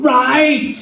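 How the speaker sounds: high-pitched mock yell; retold scream. Falling [name] screams back at God, imitated high yell. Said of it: "I just screamed out".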